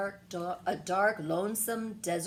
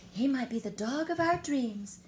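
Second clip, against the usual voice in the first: usual speaking voice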